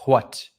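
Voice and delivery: "what" said as "hwat", with a breath of H first; H pronounced. Shown as a demonstration. Showing high-class Virginia speech, "what" starting with HW. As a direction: blow an audible H before the W in "what". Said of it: "they pronounce the H in".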